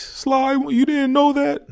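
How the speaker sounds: high-pitched mock heckler; mocking know-it-all. Viewer rubbing it in to the vlogger, high and mocking. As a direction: put on a high, taunting voice. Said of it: "don't have to rub it in my face".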